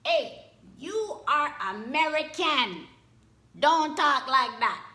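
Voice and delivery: loud, high, slow Jamaican grandma scolding; grandma's loud scolding in a Jamaican lilt. Retold or imitated. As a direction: scold him like a stern grandma. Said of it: "my grandma was like".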